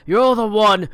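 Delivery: shouting in bitter rage; furious father. Acted. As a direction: hurl the blame at them.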